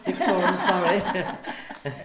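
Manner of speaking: laughing. Real, not acted. Echoing the catchphrase, laughing.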